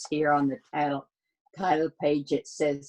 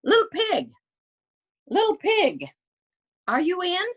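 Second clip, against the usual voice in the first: high, falsetto sing-song call; 4 dB louder than usual. Acted. Wolf calling to the pig at the door, high sing-song. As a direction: call sweetly through the door. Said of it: "so I called".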